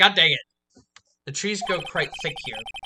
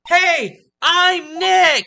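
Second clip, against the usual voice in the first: much higher, much louder yell; loud shout; 11 semitones higher, 8 dB louder, much slower than usual. Acted. Nick shouts his name louder so the camera triggers. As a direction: bellow it so the mic picks it up. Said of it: "Maybe I'm not saying it loud enough".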